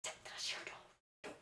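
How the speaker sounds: whispered, slow plea; urgent whisper. Retold or imitated. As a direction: hiss it so no one nearby hears. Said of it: "I'm looking at her like".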